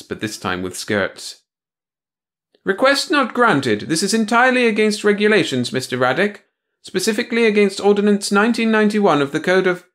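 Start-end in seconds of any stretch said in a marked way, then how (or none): 2.6-9.9 high prim bureaucrat voice; a prissy officious clerk; prim officious woman. Acted.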